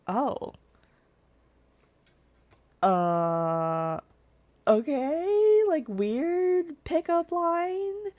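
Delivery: drawn-out, high, puzzled okay; taken aback by a strange pickup line; weirded out. Retold or imitated. Retells her reaction to the guy.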